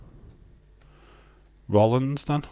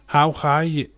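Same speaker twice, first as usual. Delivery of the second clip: higher, louder second how are you; louder repeat; 5 semitones higher, 5 dB louder than usual. Real, not acted. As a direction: call it out again with a punch on how.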